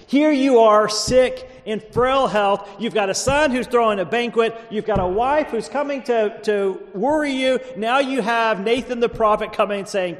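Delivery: worked-up preacher; impassioned preaching. Real, not acted. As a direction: press each trouble home from the pulpit. Preaches, putting listeners in David's shoes.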